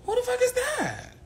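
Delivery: high quick outraged question; shocked. Pretend. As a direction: fire it off again, indignant. Repeats quicker.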